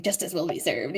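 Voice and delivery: softly hissed inner demon; demon whisper. Retold or imitated. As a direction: hush it like a tempting little devil. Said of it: "the little demon, the whispering".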